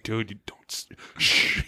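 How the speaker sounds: hushed retold whisper. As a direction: whisper it to hush them. Retold friend hushing about rumor.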